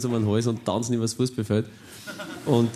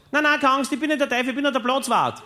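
groundskeeper's voice; 14 semitones higher than usual. Retold or imitated. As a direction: play the friendly groundskeeper way up high. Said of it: "Und er sagt".

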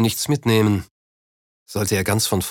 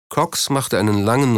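ordinary delivery

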